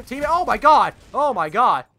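very loud, high exclamation; shocked shouting. Real, not acted. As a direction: yell out in sheer disbelief. Shock at kill in game.

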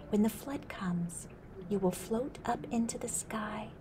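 hushed secret whisper; whispered prophecy. Acted. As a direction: whisper it like a mystery.

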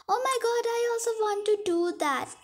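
high, eager gushing; thrilled high-pitched outburst, acted; excited. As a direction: playact an excited reaction, pitched well up. Acted excited reaction to job.

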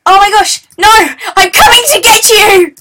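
high, loud shout of pursuit; excited battle yell. Real, not acted. Player chasing his opponent, excited threatening shout. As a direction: yell it as you charge after them.

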